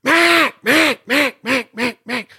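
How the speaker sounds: loud high hail call on the duck call; high ringing mallard hail call. Shown as a demonstration. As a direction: blast the hail call then let it fall off. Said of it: "getting that body language".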